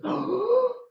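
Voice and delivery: sharp high mock gasp of shock. Wedding guests reacting to the insult, high mock gasp. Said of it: "And everyone goes".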